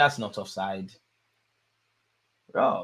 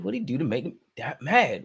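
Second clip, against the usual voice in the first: ordinary delivery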